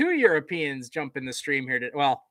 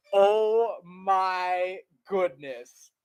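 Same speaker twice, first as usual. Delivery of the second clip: slow disbelief; 5 semitones higher, much slower than usual. Real, not acted. Reacting to absurd kickoff.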